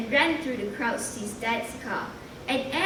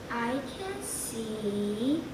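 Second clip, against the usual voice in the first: slow, hushed and measured; quietly careful, drawn out; speaking carefully; 7 dB quieter, much slower than usual. Acted. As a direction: weigh each word as you say it. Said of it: "Jamie spoke carefully".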